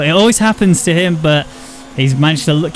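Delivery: normal talking voice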